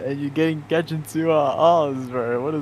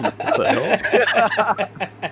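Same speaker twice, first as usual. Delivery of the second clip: laughing disbelief; 10 semitones higher, much slower than usual. Real, not acted. Incredulous laughing reaction.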